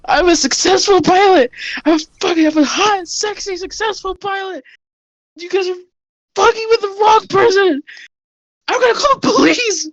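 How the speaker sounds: high-pitched hysterical prisoner; panicked captive. Acted. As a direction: squeal in terror at your captors. Oasis bragging and threatening.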